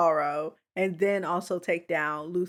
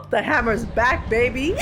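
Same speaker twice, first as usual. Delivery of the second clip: hyped; 4 semitones higher, 5 dB louder than usual. Real, not acted. Hyped at hammer returning.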